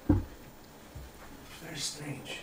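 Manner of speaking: slow, hushed [name], voice dropping away; a hint of [name]'s unease; [name] uneasy. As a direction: breathe it out warily.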